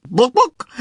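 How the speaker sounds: a hen clucking "Book"; chicken voice. Acted.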